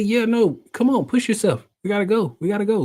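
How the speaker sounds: high, insistent hurry-along; urging therapist. Retold or imitated. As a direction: imitate a coach chivvying a patient. Acted therapist urging him along.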